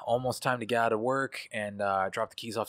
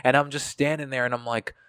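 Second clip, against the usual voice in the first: ordinary talk, nothing marked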